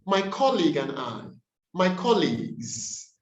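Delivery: colleague stressed on the first syllable; corrected stress. Shown as a demonstration. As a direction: lean on COL in colleague and colleagues. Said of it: "It's colleague".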